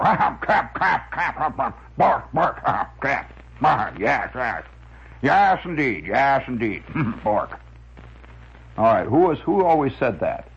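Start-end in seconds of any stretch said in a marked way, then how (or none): 0.0-7.6 blustering comic-strip windbag; windbag character. Acted. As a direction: harrumph and sputter between the words like a pompous windbag. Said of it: "he had a famous way of talking".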